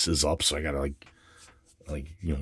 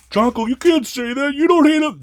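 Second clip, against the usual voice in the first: much higher, louder shocked falsetto; mock scandalized objector; 9 dB louder than usual. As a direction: play a scandalized prude scolding Jonko. Imagined objector voice.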